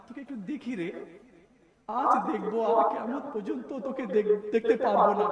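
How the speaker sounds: soft, low, tearful call to a daughter; দুঃখী কোমল ডাক, ক্রমশ নিচু; tender tearful calling. Acted. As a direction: call your little girl gently, voice fading. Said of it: "আমি ডাকবো".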